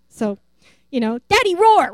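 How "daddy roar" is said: put-on high, loud child's demand; a toddler demanding her daddy roar again, high and loud; little girl's plea.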